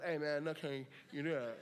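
a hint of an unbothered father; calm fatherly reassurance. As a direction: soothe the boy while pulling a hook out. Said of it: "he's calmly saying this".

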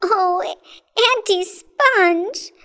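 high, slow tearful plea; crying out. A narrator's reading. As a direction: wail it as a pleading child. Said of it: "cried James".

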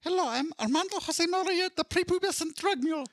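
sehr hoher, übertriebener mexikanischer Akzent; schlechter mexikanischer Akzent. Acted. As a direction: spiel einen Jungen mit dickem mexikanischem Akzent. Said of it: "I don't know why I did that accent".

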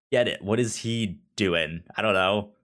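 normal talking voice

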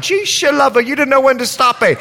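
high-pitched Bahamian granny exclaiming; Bahamian accent. Retold or imitated. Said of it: "She's Bahamian, excuse the accent".

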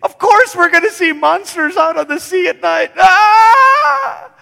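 high, loud terrified yelling; hammed-up scream of panic; panicked shout. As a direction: yell in a frightened boat crowd. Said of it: "That would have been their normal expectation".

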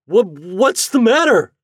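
high, loud startled splutter. A narrator's reading. Chuck woken by a wet sponge, spluttering. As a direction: stammer it out slowly in alarm. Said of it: "spluttered Chuck".

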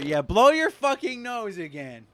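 put-on high, loud jeering kid; mocking kid voice. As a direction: taunt like a schoolyard bully. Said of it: "all the kids are gonna be saying".

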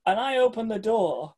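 ordinary delivery

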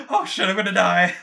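high panicked squeal. Acted. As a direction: squeal in terror. Character fears death after stab.

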